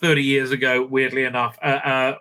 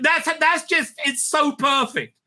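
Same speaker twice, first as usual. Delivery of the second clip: high, delighted squeal of approval; thrilled that the joke fits so well; 11 semitones higher than usual. Real, not acted. Delighted at perfect insult.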